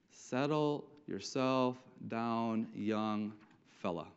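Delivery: slow right down to cool off the youngster; slow calming down. Acted. Saul reins in the eager young David, slow and subdued.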